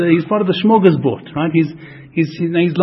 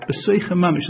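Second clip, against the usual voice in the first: ordinary delivery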